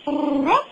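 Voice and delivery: put-on playful little woof for a toddler; dog bark. Shown as a demonstration. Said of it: "What does the doggy say?".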